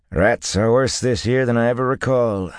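stable-keeper's understated grumble; muttered. A narrator's reading. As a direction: grumble it half to yourself. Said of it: "the stable-keeper muttered".

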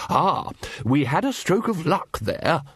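high, slow, delighted wizard; pleased Ridcully. A narrator's reading. Wizard tells Vimes of his lucky find, pleased. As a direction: swoop through it with satisfaction.

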